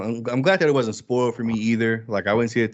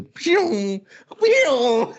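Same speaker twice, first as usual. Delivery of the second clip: high-pitched laser "pew" noise; long, squealed comic zap sound; imitated beam blast; 5 dB louder than usual. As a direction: mimic a laser beam shooting, drawn out.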